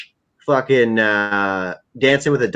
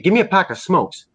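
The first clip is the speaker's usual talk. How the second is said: higher-pitched, easygoing mock request; casual retold order; 7 semitones higher than usual. Fan imitating a dealer at the store counter, casual higher request. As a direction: ask for it offhand, pitch up.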